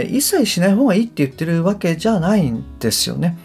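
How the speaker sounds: ordinary talk, nothing marked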